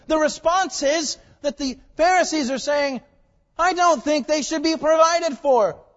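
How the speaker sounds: loud, high, impassioned sermon delivery; preaching at full force. Real, not acted. Preacher telling the congregation how God provided, at full force.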